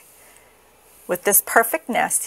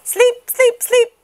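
put-on high, loud baby-animal chant, not whispered; tired babies; 5 dB louder, slower than usual. A narrator's reading. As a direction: plead in tiny high voices. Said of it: "tired little babies finally whispered".

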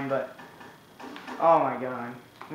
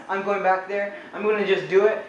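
normal talking voice

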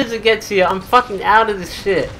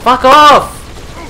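raised, irritated shouting; angry shout; 4 semitones higher, 7 dB louder, much slower than usual. Real, not acted. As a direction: shoo them off loudly. Shouting off an enemy.

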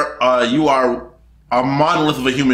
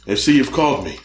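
deep Keith David impression; 4 semitones lower, faster than usual. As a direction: rumble it like a mysterious Keith David. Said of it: "He'll show us in our dreams".

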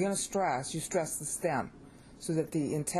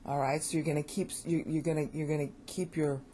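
normal talking voice

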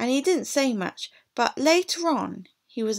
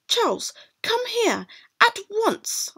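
high, sharp summons; stern mother; 12 semitones higher than usual. Acted. Mother orders her son away from the rough child, high and stern. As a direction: call him back firmly at once. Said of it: "I said".

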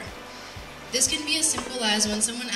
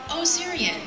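higher-pitched imitated stranger, lightly voiced; light, higher mimicry of a mistaken listener; stranger's mistaken reply; 8 semitones higher, slower than usual. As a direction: lift the pitch as a stranger who thinks they understand. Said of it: "the conversation goes something like this".

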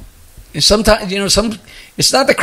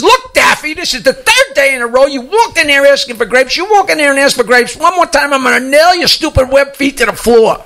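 higher, exasperated shopkeeper threat; fed-up store clerk voiced higher in a joke; irritated clerk; 6 semitones higher than usual. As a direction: warn the pest you've had enough.

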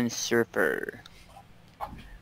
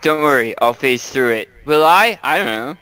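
loud, eager bravado turning unsure; cheerful boast, then doubt; excited; 3 semitones higher, 7 dB louder than usual. Acted. Character about to hit wall.

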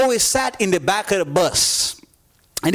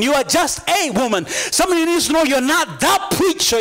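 preaching at full force; 6 semitones higher than usual. Acted. Preacher telling the congregation that God is in charge, rising to full force. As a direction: build the congregation up to a shout.

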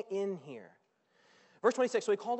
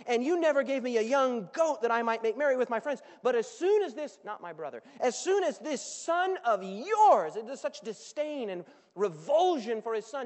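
building louder, spitting resentment; bitter complaint; 9 dB louder than usual. Acted. Older son ranting at his father, bitter and building. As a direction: let the grudge swell into a rant.

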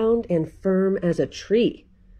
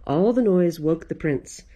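plain reading voice

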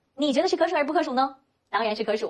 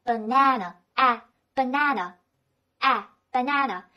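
American "banana", middle a as in "cat"; American accent. Shown as a demonstration. Teacher models the phonetic reading for learners, American accent. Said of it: "这是美国人习惯的说法".

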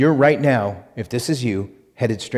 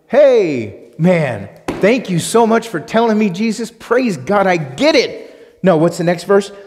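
mock grateful listener; 6 semitones higher, 4 dB louder than usual. Sarcastic mock gratitude to Jesus.

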